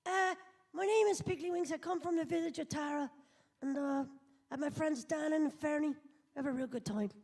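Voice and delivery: high Irish cartoon pig voice; young Irish lad, cheerful; Irish accent. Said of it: "Should I do Piggly?".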